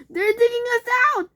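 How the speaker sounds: high, fast panicked cry; frantic warning; alarmed. A narrator's reading. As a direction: blurt it out in panic. Fox alarm, digging overhead.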